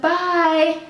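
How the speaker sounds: high, drawn-out mock goodbye; sarcastic farewell. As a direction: mimic a sarcastic, dismissive send-off. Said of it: "you know how, like, sarcastic".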